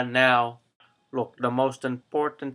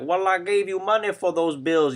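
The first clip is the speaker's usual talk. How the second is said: protesting father; 6 semitones higher, 3 dB louder than usual. Retold or imitated. Father protests to spendthrift kid.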